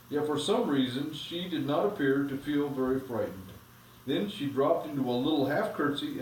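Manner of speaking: put-on steady storybook reading to the kids; reading aloud to children. A narrator's reading. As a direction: read the chapter aloud, evenly paced. Parent reads a story aloud.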